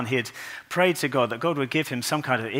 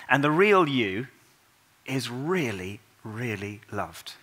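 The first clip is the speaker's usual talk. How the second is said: tender; slower than usual. Real, not acted. Tender climax of the sermon.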